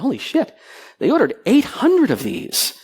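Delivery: mock disbelief at a big order; astonished. Seeing eight hundred shrouds ordered, astonished. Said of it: "I went".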